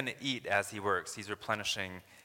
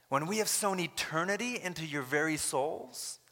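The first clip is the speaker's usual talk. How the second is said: voicing Paul; 5 semitones higher than usual. Paul to the Corinthians, pleading and incredulous. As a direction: plead like the apostle, rising in disbelief. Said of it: "Paul's saying".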